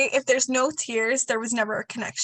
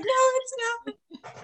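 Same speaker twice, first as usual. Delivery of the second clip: high protest; 13 semitones higher than usual. Retold or imitated. Retold client's high protest.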